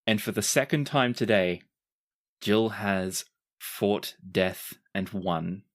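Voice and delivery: ordinary delivery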